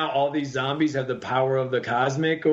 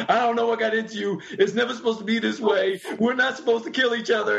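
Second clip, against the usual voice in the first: Sandman upset; 8 semitones higher, faster than usual. Retold or imitated. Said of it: "And he's like".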